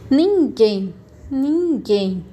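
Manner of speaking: ninguém slowly, NIN and GUÉM drawn out; syllable by syllable. Shown as a demonstration. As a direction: sound out ninguém in two long beats. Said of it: "que a gente fala mais alto".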